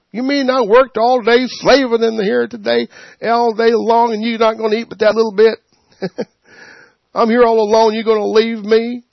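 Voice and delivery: lightly voiced whiny mother heaping on guilt; guilt-tripping mother.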